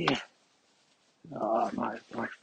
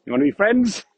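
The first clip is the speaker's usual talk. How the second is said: talking to a dog; 5 semitones higher, 9 dB louder than usual. Real, not acted. Greeting a strange dog.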